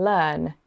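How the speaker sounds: "learn" with a long vowel and no R sound; non-rhotic English "learn", R dropped before the N; silent R. Shown as a demonstration. Teacher explains their English accent, "learn" with silent R. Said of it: "we don't pronounce the R".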